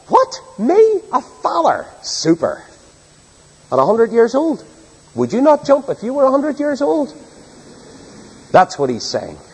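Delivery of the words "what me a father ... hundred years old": much higher, bursting with glad disbelief; a centenarian overjoyed at the news of a son; Abram's delighted laugh. Retold or imitated. Preacher voicing Abram hearing he'll be a father, delighted and high. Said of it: "Abram's laugh is a laugh of delight".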